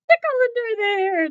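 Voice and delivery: playful squeal. Acted. Teacher squealing the tickle line to the children. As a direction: burst up high for the tickle. Rhyme's tickle climax again.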